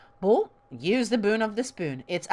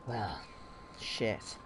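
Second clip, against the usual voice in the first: low, quiet, two words apart; The Witcher; 9 semitones lower, 11 dB quieter than usual. Retold or imitated. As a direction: imitate a monster hunter, low with a pause between words. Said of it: "sounded a bit more like The Witcher".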